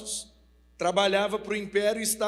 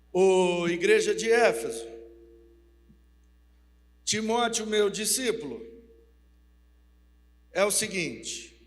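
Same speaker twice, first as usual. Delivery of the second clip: loud, solemn address to the church; Paul dictating his letter to Ephesus, proclaiming; 5 dB louder than usual. Acted. (proclamação solene e alta)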